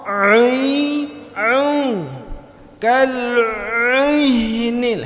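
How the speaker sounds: teacher mocking the wrong ain; mock heavy ain. Shown as a demonstration. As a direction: overdo the ain, pushed up and thick. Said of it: "hana perlu mekelok tadi".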